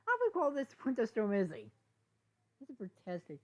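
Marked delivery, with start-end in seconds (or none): 0.1-1.7 laughing. Acted. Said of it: "He then began laughing".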